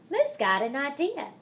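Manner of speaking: ordinary delivery, nothing marked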